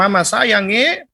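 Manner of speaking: eastern Indonesian lilt, rising at the end; eastern Indonesian accent. Shown as a demonstration. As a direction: demonstrate a Maluku-style accent, pitch swinging up. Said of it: "dia ngomong dengan intonasi yang keras". (logat Indonesia timur, nada naik)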